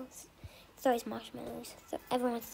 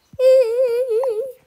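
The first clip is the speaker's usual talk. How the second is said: high, loud cackle of a bully; bully laughing at the crybaby; mocking laugh; 10 semitones higher, 16 dB louder than usual. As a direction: laugh in their face. Laugh after taunting.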